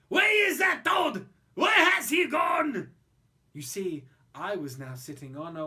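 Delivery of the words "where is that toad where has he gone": high, loud angry shout; shouting Frenchmen. Acted. Frenchmen with knives shout for the vanished toad, loud and high. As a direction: yell in confusion after the smoke. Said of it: "the Frenchmen with their knives cried".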